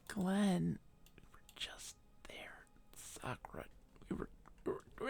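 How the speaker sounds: hushed unvoiced whisper. Real, not acted. Streamer whispering to a friend about the map. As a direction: whisper it as if not to be overheard.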